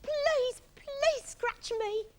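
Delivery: high, drawn-out pleading; Iggy pleading excitedly. A narrator's reading. Iggy begging Monkey to scratch, eager high plea. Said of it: "shivering with excitement".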